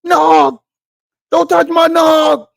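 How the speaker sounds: high, loud, slow frantic yell; guard the nog like a frantic cartoon dad; cartoon dad bugging out. Imitating Timmy's dad over his eggnog, frantic high yell. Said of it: "how he be bugging over his eggnog".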